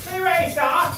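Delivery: sharp high call to stop the cat; high yelp to stop the cat. Real, not acted. Yelling at the cat.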